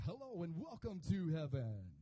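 lightly voiced radio host God welcoming people. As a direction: welcome them like a broadcast host. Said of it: "God's like".

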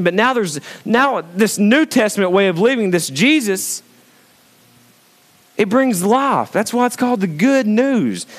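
high, ringing sermon delivery; impassioned preaching. Acted. Preaches the verse.

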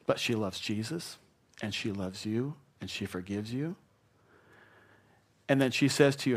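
normal talking voice

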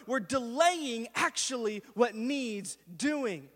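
loud, high, fired-up sermon climax; full-force preaching. Acted. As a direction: preach it like the whole room must hear.

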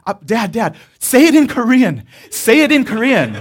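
urgent pleading. Retold or imitated. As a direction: beg him loud and fast. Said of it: "I was pleading with my dad".

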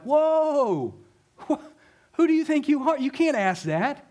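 high-pitched outraged objection; acted indignant protest. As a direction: cut in as an affronted disciple, pitch way up. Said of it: "the disciples' anger".

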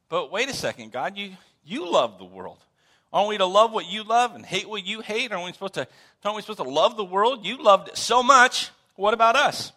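mock-indignant appeal rising in pitch and volume; puzzled protest. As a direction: plead the case to God, building in urgency. Acts out protesting to God.

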